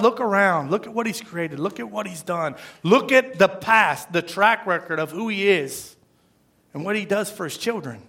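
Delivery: ordinary delivery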